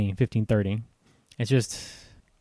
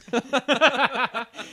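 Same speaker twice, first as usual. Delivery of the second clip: laughing; 11 semitones higher than usual. Real, not acted. Reacting to the crazy voice.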